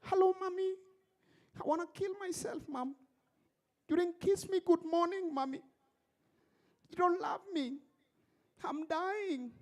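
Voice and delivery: high whiny daughter, mock sobbing on the phone; a hammed-up tearful girl calling her mother; mock crying girl. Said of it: "She's crying".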